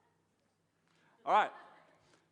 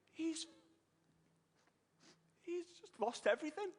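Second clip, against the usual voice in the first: choked up; 8 semitones higher, 3 dB louder than usual. Real, not acted. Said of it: "I'm reliving the story here".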